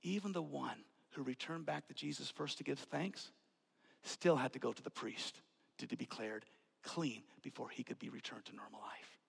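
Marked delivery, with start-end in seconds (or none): 4.0-9.2 hushed, low. Acted. Preacher repeating the law to congregation, softly hushed. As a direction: lower and much softer, fading to a whisper.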